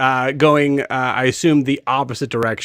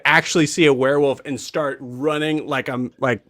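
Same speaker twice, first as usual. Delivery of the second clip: ordinary talk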